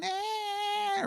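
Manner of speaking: high whimpering baby cry imitation; mock baby whimper. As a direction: squeak out a little baby fuss. Imitating crying baby daughter.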